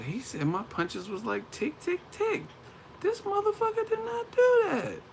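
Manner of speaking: climbing to an incredulous high squeal; mock outrage, very high-pitched; delayed indignation. Acts out delayed hurt indignation.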